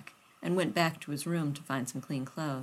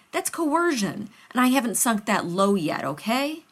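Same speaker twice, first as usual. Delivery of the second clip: exasperated; 5 semitones higher, 6 dB louder than usual. Acted. John exasperated, arguing.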